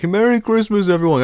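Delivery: high, loud jolly Santa greeting; hammed-up Santa calling to shoppers. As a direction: boom out a cheery Santa hello. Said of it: "I'd even put on, like, a voice".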